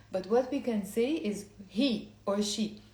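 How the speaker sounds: ordinary delivery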